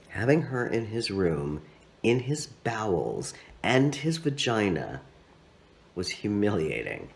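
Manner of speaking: plain reading voice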